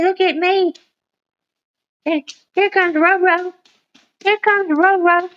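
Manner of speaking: high, cutesy pet voice; dog's voice. Pretend. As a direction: speak for the dog in a high sing-song. Voicing the dog for viewers.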